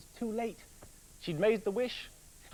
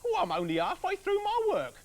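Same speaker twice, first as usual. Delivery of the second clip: very high, loud nagging wife; grumbling wife; 7 dB louder than usual. Acted. Busy wife grumbles at her husband coming home, high and loud. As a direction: grumble at him in a shrill housewife voice. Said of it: "she grumbled".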